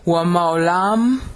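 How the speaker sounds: drawn-out chant-like proclamation; thundering Hebrew. Shown as a demonstration. Teacher voicing the Hebrew for his class, thundering. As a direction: intone it slowly, with rising pitch. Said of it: "the thundering Hebrew".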